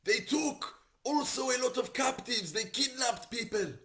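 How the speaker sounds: very high, loud frantic yelling; hammed-up panicked shout; acted yell. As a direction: yell the news out in alarm. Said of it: "runs out from the ashes and yells".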